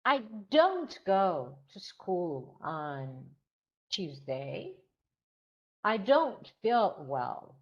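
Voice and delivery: don't punched and stretched, slow; stress on don't. Shown as a demonstration. Teacher showing students the stressed negative, emphatic. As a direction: lean hard on each don't. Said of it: "So you put a stress".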